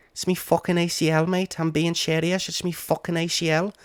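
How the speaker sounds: high-pitched mock Scouse; imitated Scouse accent. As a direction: put on a high, slushy Liverpool accent. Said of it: "your accent adds to it".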